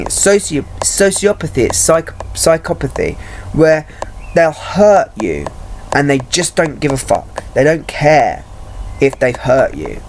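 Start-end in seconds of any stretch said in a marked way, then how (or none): none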